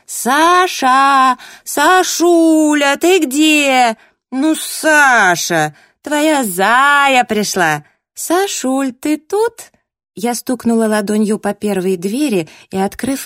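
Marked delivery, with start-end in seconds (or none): 0.0-9.7 put-on loud, high, slow drunken wailing; hollering like a town drunk. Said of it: "заорала совершенно пьяным голосом".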